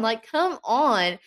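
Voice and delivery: usual speaking voice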